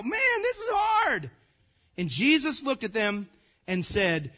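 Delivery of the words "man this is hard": high, drawn-out mock dismay; overwhelmed, hammed-up whine; exasperated complaint. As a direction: groan the words slowly, pitched way up. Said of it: "Kinda like we're feeling right now".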